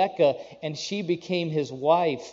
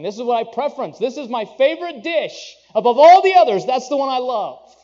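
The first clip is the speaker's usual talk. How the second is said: climbing higher and louder until it bursts out; rising delight; 7 semitones higher, 9 dB louder than usual. Acted. Preacher voicing a man raving about food, building excitement. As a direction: build from pleased to a near shout over the favorite dish.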